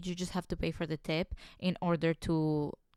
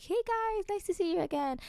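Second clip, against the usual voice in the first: high, bright mock greeting, a little softer; much higher sing-song waitress hello; cheery server greeting; 12 semitones higher, 4 dB quieter than usual. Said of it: "I will come like".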